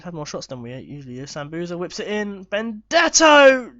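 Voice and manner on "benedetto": high, loud, drawn-out scream of the name; excited shout. Real, not acted. Gamer screaming at his striker's chance. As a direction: yell it as the ball flies at goal. Near miss while playing.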